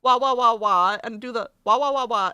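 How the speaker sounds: playful cartoon crying chant; mock wailing. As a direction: wail along to a beat as a joke. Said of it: "cry for the rhythm".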